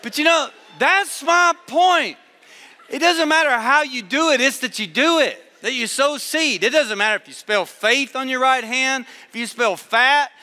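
emphatic preacher's cadence; preaching at full force. Acted. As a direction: drive it home to the congregation. Preacher drives the point home.

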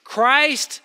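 loud, high, hammering sermon delivery; full-force preaching. Acted. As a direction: pound each line home to the students. Preacher reads scripture forcefully.

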